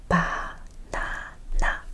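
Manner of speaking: Banana stretched out very slowly; slow demo. Shown as a demonstration. Teacher slowly sounding out Banana. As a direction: draw out each syllable of Banana. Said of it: "Same vowel".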